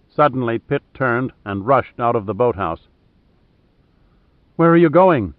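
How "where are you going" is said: raised, louder shout after someone leaving; shouted question. A narrator's reading. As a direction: call it out higher and louder, as narrated dialogue. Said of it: "shouted Julia".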